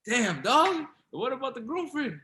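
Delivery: high, loud cry of frustration; exclaiming. Real, not acted. Forgetting the song title, cries out to his friend. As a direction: throw up your hands at yourself.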